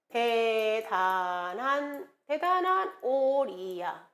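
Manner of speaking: very drawn-out syllables; slow, stretched recitation; slow mnemonic drill. Shown as a demonstration. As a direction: chant it slowly for students to memorize.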